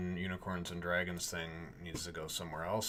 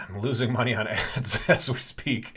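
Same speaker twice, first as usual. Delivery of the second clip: laughing; 5 semitones higher, 11 dB louder than usual. Real, not acted. Laughing about losing money.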